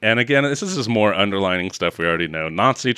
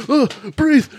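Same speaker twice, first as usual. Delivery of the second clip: much higher, louder, hammed-up panic; frantic medic parody; 4 dB louder than usual. Mocking Clooney's medics in a tent, high hammed panic. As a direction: ham up a frantic field medic.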